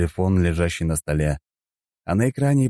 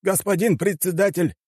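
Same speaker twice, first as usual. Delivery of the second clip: high-pitched assistant voice; помощник председателя; 13 semitones higher than usual. A narrator's reading. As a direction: call the boss in a high voice. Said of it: "В комнату забежал первый помощник". (высокий голос помощника)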